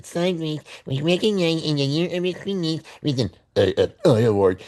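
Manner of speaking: high put-on citation voice; mocking read-aloud. Reading the award citation to listeners, mocking high voice.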